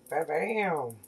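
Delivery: normal talking voice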